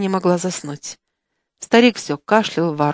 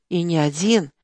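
plain reading voice

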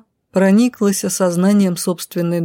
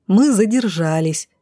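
usual speaking voice

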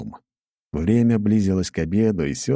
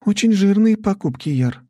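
doubtful; 5 dB louder than usual. A narrator's reading. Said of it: "с сомнением ответила Настя".

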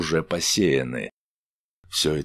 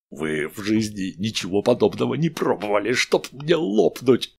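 tongue tangling with drink; slurred drunk speech; 4 semitones higher than usual. A narrator's reading. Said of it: "заплетающимся языком".

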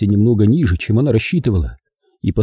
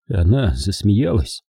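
plain reading voice

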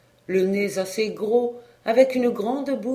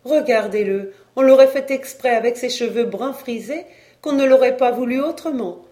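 high, loud, proud delight; 4 semitones higher, 7 dB louder than usual. A narrator's reading. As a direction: show him off joyfully. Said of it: "toute réjouie". (toute réjouie, voix haute et forte)

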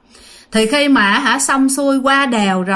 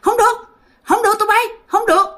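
high ghostly shout of no; 10 semitones higher than usual. Acted. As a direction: cry out to stop the others. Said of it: "la lên".

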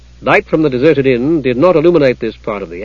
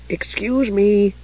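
high, slow, polite kender voice; polite Tass; 7 semitones higher, 5 dB quieter, much slower than usual. A narrator's reading. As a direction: interrupt politely, high and drawn out. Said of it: "said Tass politely".

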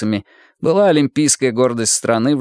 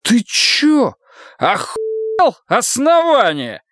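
slurred yell; 12 semitones higher, much slower than usual. Acted. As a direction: yell drunkenly, tripping over words. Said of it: "заплетаясь, заорал лейтенант".

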